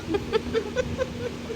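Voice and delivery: high, light laugh; laughter. Real, not acted. Guest answering the host's teasing, laughing. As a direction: burst into amused laughter.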